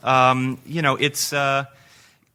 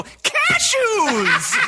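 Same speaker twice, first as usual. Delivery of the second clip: very high, long joke-saving shout; high-pitched yell. Shown as a demonstration. Demonstrating how to rescue a joke, high-pitched yell. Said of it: "yell something in a high-pitched voice".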